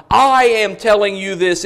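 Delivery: "I" loud and held at the start; stress on I. Shown as a demonstration. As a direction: hit "I" hard, as the one with authority. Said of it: "really emphatic on the pronoun".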